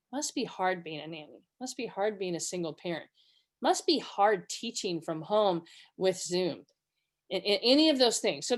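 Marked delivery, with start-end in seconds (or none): 0.1-6.6 low, soft understanding; gently validate, voice dropped and unhurried; empathetic. Shown as a demonstration. Showing someone I am there with you, quiet empathy. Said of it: "communicate that I am there with you".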